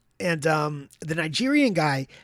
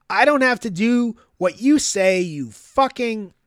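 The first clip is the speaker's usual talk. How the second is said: higher, louder defiant snarl, slowing on the insult; retold angry outburst; 6 semitones higher, 6 dB louder than usual. Patient refusing the orderly's order, angry defiant yell. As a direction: spit the words back in defiance. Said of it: "in the utmost anger".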